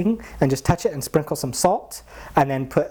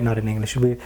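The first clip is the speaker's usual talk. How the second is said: usual speaking voice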